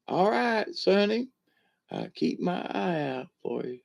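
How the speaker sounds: punchline granny voice. Retold or imitated. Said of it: "long story for a short little, um, punchline".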